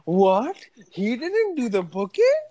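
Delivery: a high incredulous gasp of a question; shocked what. Retold or imitated. Coworker hearing the venue was never booked, shocked. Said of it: "to which Langston replied".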